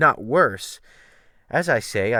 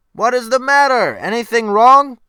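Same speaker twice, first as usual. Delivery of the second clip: hailing loudly from another boat, much higher; calling out; 11 semitones higher, 10 dB louder than usual. Acted. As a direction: shout the question across the waves. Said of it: "This was the cry".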